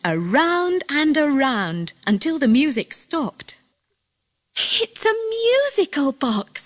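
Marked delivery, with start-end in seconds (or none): 4.6-6.6 high, drawn-out delighted cry; excited cry. A narrator's reading. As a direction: cry it out high and slow, thrilled. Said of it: "cried Sarah".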